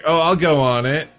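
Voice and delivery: imitating a stranger. Solo rider volunteering to friend, eager voice. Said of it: "and he was like".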